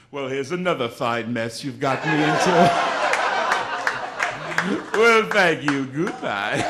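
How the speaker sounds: Oliver Hardy. Acted. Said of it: "Here is Oliver Hardy calling the sex hotline".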